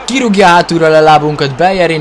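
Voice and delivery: loud, high excited cry; excited shout. Real, not acted. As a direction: shout with delight at the play. Goal chance excitement in FIFA.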